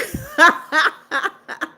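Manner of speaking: high, loud burst of laughter; real laugh. Real, not acted. Streamer amused by a viewer too broke to donate, laughing out loud.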